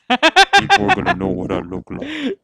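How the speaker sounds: squeaky high laughing speech. Real, not acted. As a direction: laugh through the words helplessly. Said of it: "laughing through disguise".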